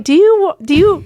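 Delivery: loud, high-pitched indignant stammer; incredulous retort. Retold or imitated. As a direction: sputter it in mock disbelief. Acted indignant reply to roommate.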